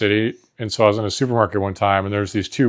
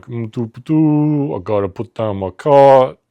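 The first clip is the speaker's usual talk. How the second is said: loud, hammy Jersey Italian workers; Jersey Italian tough guys; 4 semitones higher, 4 dB louder than usual. Acted. Two grocery workers bonding, loud hammy Jersey Italian accent. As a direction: ham it up as gabagool-loving tough guys. Acting out supermarket Jersey skit.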